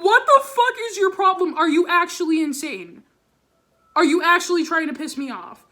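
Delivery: loud, high furious yelling at the teacher; furious retort. Retold or imitated. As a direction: let the rage burst out at her. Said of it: "I was so heated".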